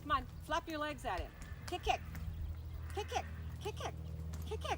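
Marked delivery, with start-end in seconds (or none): 1.7-4.8 rising urgent kick kick; urging kick kick. Real, not acted. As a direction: chant it higher each time. Urging rider to kick horse.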